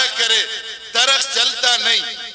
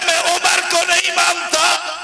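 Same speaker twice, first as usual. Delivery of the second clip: loud defiant shout; 7 semitones higher than usual. Acted. Heckler defying the preacher, loud mock shout. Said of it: "زور سے".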